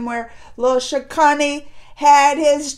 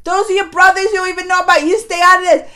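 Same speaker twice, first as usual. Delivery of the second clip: loud, raised-pitch telling-off; mad scolding; 4 semitones higher, 6 dB louder, faster than usual. Retold or imitated. Viewer telling Karna to keep out, loud mock scolding. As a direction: warn him off, annoyed and emphatic. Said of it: "I was mad at Karna too".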